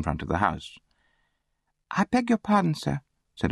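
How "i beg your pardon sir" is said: put-on high-pitched child's courtesy; polite orphan boy. A narrator's reading. Orphan addresses the boy on the post, high polite voice. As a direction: ask politely in a high boy's voice.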